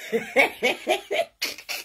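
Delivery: airy breathless laugh of mockery; scornful giggle; mocking laugh. Real, not acted. Mocking laugh after curse.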